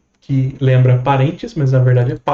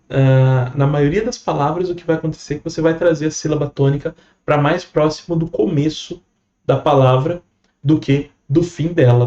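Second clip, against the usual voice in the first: ordinary delivery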